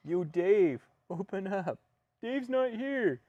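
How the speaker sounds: high stoner voice, laid-back; Cheech and Chong impression. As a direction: drawl it like a stoned comic. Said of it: "You remember that skit?".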